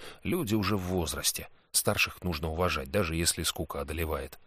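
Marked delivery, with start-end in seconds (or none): none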